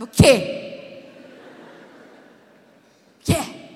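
loud, exasperated "O quê?"; irritated snap. Retold or imitated. Wife fed up after being called six times. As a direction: snap back at him.

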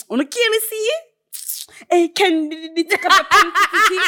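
high mimicry breaking into laughter; imitating cousin. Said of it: "hear my cousin come in".

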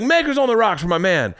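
high-pitched mock yell of a drink order; imitated barroom yell. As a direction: call out the order like a big-shot imitation. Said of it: "he was yelling at Harvey Keitel".

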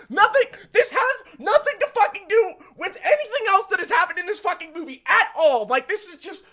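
high-pitched, incredulous ranting; exasperated rant. Real, not acted. Critic railing at a twist that came from nowhere, exasperated rant.

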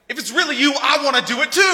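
high, loud, hammed-up excitement; mock eager shout. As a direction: shout it like an overeager volunteer, played for laughs. Playfully voicing overeager Peter in storm.

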